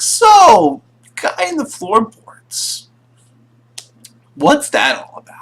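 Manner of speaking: high, loud "so", then halting question; awkward probing. Acted. Friends ask the priest about floorboard captive, awkwardly probing. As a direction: broach it with awkward, acted pauses.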